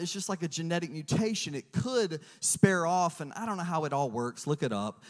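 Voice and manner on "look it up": ordinary talk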